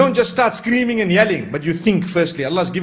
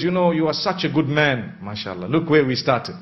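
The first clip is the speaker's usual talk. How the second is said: soft, lower, tender and praising; gentle loving words; 6 semitones lower, 4 dB quieter than usual. Shown as a demonstration. Wife praising her husband before raising a hurt, softly tender. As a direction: coax him kindly before raising the issue. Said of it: "To show you that there is a way of speaking".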